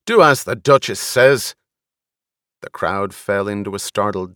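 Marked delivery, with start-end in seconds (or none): 0.0-1.5 loud, higher-pitched snap; sharp, raised-voice backing of an order; snapped order. A narrator's reading. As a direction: snap a command at the driver. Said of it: "Gurney snapped".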